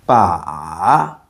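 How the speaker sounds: "ba" dipping low, then rising; long low scooping "ba"; third tone. Shown as a demonstration. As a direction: drop "ba" deep and bring it back up. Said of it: "it goes down, then up".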